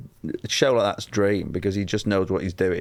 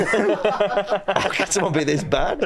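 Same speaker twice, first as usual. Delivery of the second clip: high, drawn-out, laughing dismay; laughing disbelief; 10 semitones higher, much slower than usual. Retold or imitated. Comedian voicing the actor's aghast reaction, laughing. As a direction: barely get it out through laughter.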